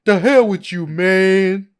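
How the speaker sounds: high, loud, drawn-out impression; James Franco. Host impersonates a blacklisted actor swearing, slow loud impression. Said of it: "That's my James Franco impression".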